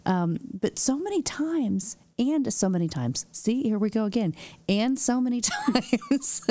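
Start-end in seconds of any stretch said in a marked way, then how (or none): 4.7-6.4 laughing through the words; slowed, amused self-mockery. Real, not acted. Laughs at own repeated phrase.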